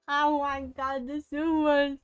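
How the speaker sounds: high, loud flustered squeal; excited squeal. Real, not acted. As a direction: squeal with giddy surprise. Chat teases, streamer reacts.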